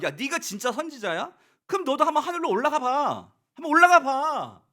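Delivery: loud high taunting dare; mocking dare. Children daring Elisha to ascend, loud mockery. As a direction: goad him to go up, jeering. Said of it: "이게 조롱이에요".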